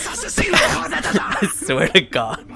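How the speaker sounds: laughing hard through the words; cracking up mid-sentence. Real, not acted. Laughing at an absurd question.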